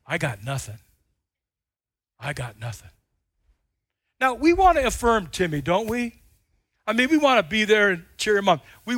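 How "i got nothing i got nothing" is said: much lower, soft, defeated; dejected mumble. Retold or imitated. Timmy walking to the dugout, low defeated mumble. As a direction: mumble it with head down. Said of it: "I thought I heard Timmy say".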